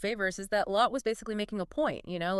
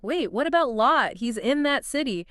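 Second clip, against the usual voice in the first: mock worried; 5 semitones higher, 4 dB louder than usual. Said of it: "Abraham's worried".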